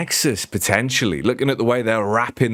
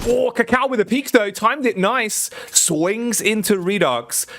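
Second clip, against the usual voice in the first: excited play-by-play; 11 semitones higher than usual. Real, not acted. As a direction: stretch the key moments of the kill. Live play-by-play of a fight.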